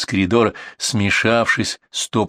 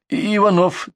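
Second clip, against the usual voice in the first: quick nervous stammer, pitched high; nervous addict blurting a fake name; hurried stammer; 11 semitones higher than usual. A narrator's reading. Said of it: "торопливо произнёс первый наркоман".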